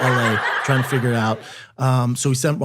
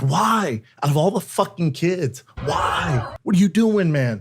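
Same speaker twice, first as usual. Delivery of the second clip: anguished shout at God; 5 semitones higher, slower than usual. Retold or imitated. A father shouting at God over his sick son, anguished. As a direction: cry out to God, demanding why his son.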